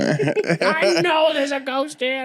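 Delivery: high-pitched mock old-lady rant; insistent old woman. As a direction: draw it out high, then rush the vow. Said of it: "an old woman being like".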